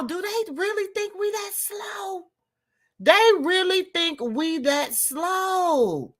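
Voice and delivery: high, drawn-out disbelief; can't believe they'd think that; incredulous. Real, not acted. Ranting to viewers, high and incredulous. Outraged rant about a cartoon.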